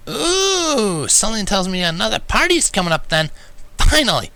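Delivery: high, loud eager tomboy voice; high, loud, eager exclaiming; lazy exclamation. A narrator's reading. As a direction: cheer at a party coming up. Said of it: "she lazily exclaimed".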